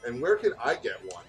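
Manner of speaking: ordinary delivery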